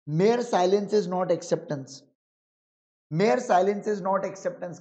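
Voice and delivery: each word of the rule laid out slowly; slow dictation. Shown as a demonstration. Teacher dictating the rule to the class, slowly. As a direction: dictate it so students can write.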